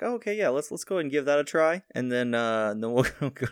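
high, sugary helpdesk voice; customer service voice. Retold or imitated. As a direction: play a chipper support agent. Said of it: "[name] with the customer service voice".

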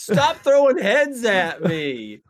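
hammed-up annoyed sheriff; mock indignant yell. Sheriff yelling at Kelly, mock indignant. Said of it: "He's like".

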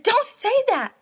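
high, loud outburst imitating an upset kid; hammed-up defensive child's protest; indignant retort. Retold child snapping at mom.

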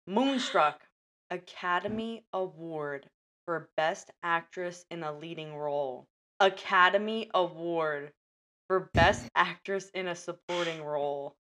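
dramatic, paused movie-trailer read; trailer voiceover. Acted. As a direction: sell it like a trailer.

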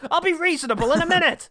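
high, loud mock rant; hammy mock villain. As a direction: ham it up like a crazed villain. Mock movie line, laughing.

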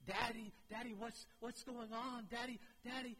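put-on high pleading little boy calling for daddy; scared child crying out. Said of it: "when he cries out to me".

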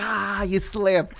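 high, drawn-out teasing; mock taunt. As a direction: stretch it out high, teasing the one who slipped. Acting his taunt at the slipped one.

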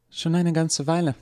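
put-on young woman Eva. A narrator's reading. Eva calmly answers the doctor, light young voice. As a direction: give Eva her bright young voice. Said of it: "antwortete Eva".

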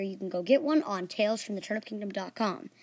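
usual speaking voice

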